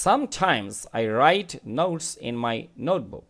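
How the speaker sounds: each word read out slowly and clearly; slow model reading. Shown as a demonstration. Teacher reading the sentence slowly to students. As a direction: read it slowly for the class to follow. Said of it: "teacher reads model sentence slowly".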